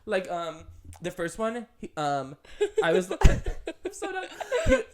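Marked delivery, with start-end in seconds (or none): 2.8-4.8 breaking into high laughter; laughing. Real, not acted. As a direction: laugh through the words. Laughing at own embarrassing story.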